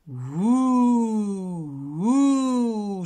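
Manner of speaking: a mild, playful ghost moan. Acted. Se déguise en fantôme. (léger hululement de faux fantôme)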